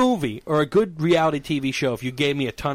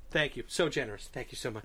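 hushed thanks; 3 semitones lower, 11 dB quieter than usual. Retold or imitated. Staff quietly thank him for tip.

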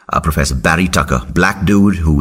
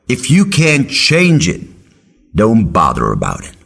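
slow, higher, insistent mentor; wise old mentor insisting; 6 semitones higher, slower than usual. Retold or imitated. Professor slowly repeating his advice to the student. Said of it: "He's like".

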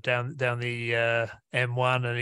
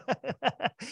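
laugh; 11 semitones higher, 5 dB quieter than usual. Real, not acted. Laughs mid-quote.

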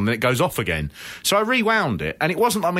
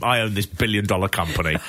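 ordinary talk

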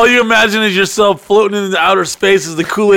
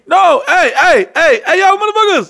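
very high, frantic protest; acted high-pitched alarm; panicked yelling; 13 semitones higher, 3 dB louder than usual. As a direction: holler to stop the ride. Acts yelling to stop the ride.

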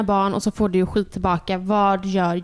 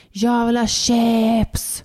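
drawn-out mock scream of a toddler; slow, strung-out imitation yell; child's scream; much slower than usual. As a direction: imitate a kid screaming out, stretching the words. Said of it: "skriker ut".

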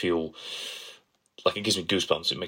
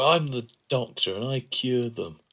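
higher, slow swaggering Doctor line; imitating the Doctor; 6 semitones higher, slower than usual. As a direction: drawl it out like a cocky hero. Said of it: "Slicking his wet hair back".

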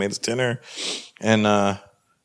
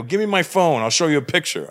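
insistent demand; 7 semitones higher than usual. Acted. Acted demand to interrogator.

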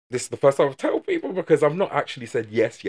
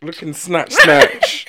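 laughing; 5 semitones higher, 12 dB louder, much slower than usual. Real, not acted. Teasing friend, laughing.

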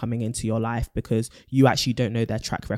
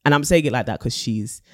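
ordinary talk, nothing marked

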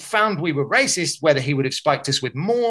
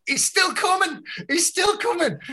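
much higher, panicky mimicked warning; panicked warning; 14 semitones higher than usual. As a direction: squeak it out as if he's right behind you. Mate warns them while fleeing.

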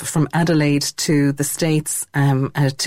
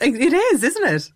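higher laughing agreement; giggling along; 7 semitones higher than usual. Real, not acted. Host laughing back in agreement with the actor.

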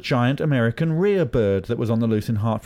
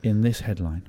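usual speaking voice

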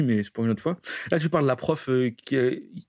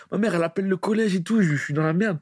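usual speaking voice

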